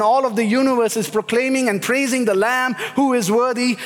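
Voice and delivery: ordinary talk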